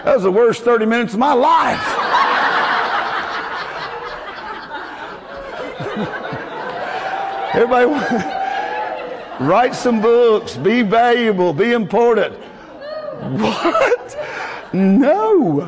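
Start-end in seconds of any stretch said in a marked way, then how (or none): 1.9-7.5 wheezing high-pitched laughter; high breathless laugh. Real, not acted. Preacher laughing at his office story, wheezing laugh. 7.9-9.3 short wheezing laugh; a quick silent laugh; breathy laugh. Real, not acted. Preacher still laughing at himself, breathy laugh. Laughing at his own story. 12.3-15.6 laughing mock refusal. Real, not acted. Preacher laughing off the idea of being important, mock refusal.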